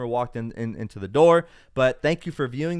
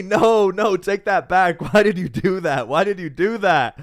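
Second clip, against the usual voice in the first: excited protest; 7 semitones higher, 7 dB louder than usual. Real, not acted. Protesting a viewer's super chat.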